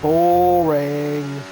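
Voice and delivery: bored groan. Real, not acted. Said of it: "bored verdict on race".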